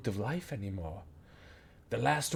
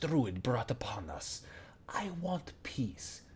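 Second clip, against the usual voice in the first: tired whisper; 7 dB quieter than usual. Acted. Goblin oracle confessing to the party she is sick of war, whispered. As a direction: let the words fall to a breath, worn out by war. Weary oracle confides her wish.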